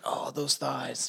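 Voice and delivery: low, slow, soft creepy leer; creepy Jeffrey Dahmer. Retold or imitated. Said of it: "Like Jeffrey Dahmer watching him".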